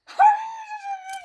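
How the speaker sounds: high-pitched scream of fright; frightened shriek. Acted. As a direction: shriek in sudden terror.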